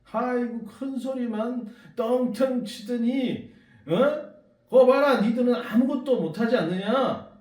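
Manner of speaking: high, loud scornful taunt; mocking jeer. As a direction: sneer loudly at their failure. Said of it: "비웃기 시작하는 거예요".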